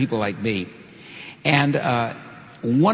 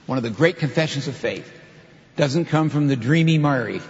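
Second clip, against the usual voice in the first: ordinary talk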